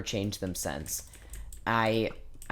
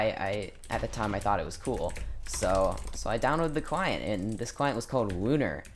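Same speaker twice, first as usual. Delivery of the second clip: normal talking voice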